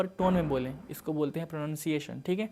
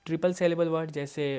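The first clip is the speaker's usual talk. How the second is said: normal talking voice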